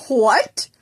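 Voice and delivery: loud, shocked disbelief; stunned what. Acted. Teacher shocked by his student's confession, loud. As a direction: hit it like a slap of surprise.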